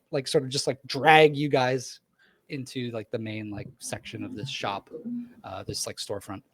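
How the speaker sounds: ordinary talk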